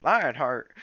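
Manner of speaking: loud, happy call of a name; glad call. A narrator's reading. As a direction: call out to the mentor. Greeting mentor.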